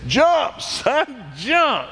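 calling urgently to his son across the stream; retold scream. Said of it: "my dad screams".

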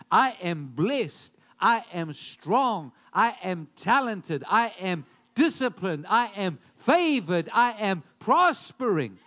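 loud, slow, deliberate declarations; bold affirmation. Acted. Preacher showing the congregation what to invite into their lives, bold affirmation. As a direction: declare each one forcefully, word by word.

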